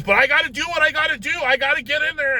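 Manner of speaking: high, loud, rapid insistence; gung-ho doctor. Retold or imitated. Doctor justifying himself to patient's wife, loud ungentle insistence. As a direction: push it out fast and forceful, not gentle. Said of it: "you don't sound very gentle".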